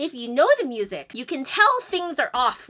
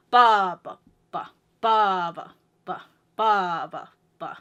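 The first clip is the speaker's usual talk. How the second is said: strong "ba", then two low weak "ba"s; lilting "ba", weight on one; three-four accents; 3 semitones lower, 3 dB louder than usual. Shown as a demonstration. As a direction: chant BA-ba-ba in threes. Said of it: "Sounds very different from".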